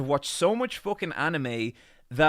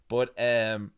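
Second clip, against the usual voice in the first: usual speaking voice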